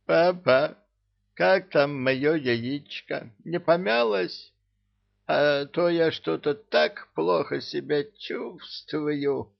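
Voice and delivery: put-on high, slurred drunken whining. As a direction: drunk villain whining to his father. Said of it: "пьяно икнул".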